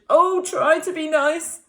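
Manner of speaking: high pleading whine; mock scared lion; whimpering plea. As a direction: plead with the mouse. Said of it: "he whimpered".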